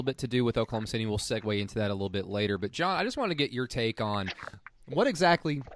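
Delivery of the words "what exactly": rough throat from the cold; hoarse from cold. Real, not acted. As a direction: voice catching from the cold. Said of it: "I can't talk because it's cold outside".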